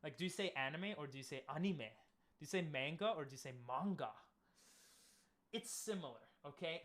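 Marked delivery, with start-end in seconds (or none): 1.5-1.8 ANIME with a Japanese accent, open AH first vowel. Shown as a demonstration. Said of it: "with a Japanese accent". 3.7-4.1 MANGA with a Japanese accent, open AH vowel. Shown as a demonstration. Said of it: "with a Japanese accent".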